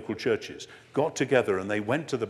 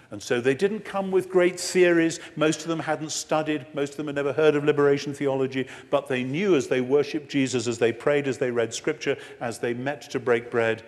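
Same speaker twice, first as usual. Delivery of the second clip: rising preaching; 4 semitones higher, 5 dB louder than usual. Real, not acted. Preaching at full force.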